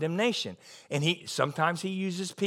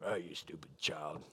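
low, soft sneering parent; a much lower, softer scornful mock parent; mock scolding parent; 13 semitones lower, 14 dB quieter than usual. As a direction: belittle the child quietly. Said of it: "Just dump guilt on them?".

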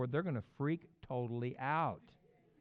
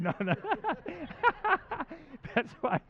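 normal talking voice

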